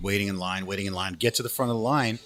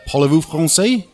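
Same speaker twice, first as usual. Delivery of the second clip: louder, higher policeman challenge; imitating policeman's French question; 6 semitones higher, 8 dB louder than usual. Mountie questions an arriving traveller in French, loud mock-official voice. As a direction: pipe up loudly as the questioning officer.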